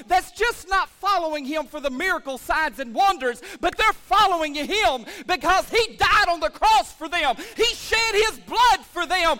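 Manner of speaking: rally the church to commit; full-force preaching. Acted. Pastor calling the congregation to go all in, preaching hard. Altar-call climax.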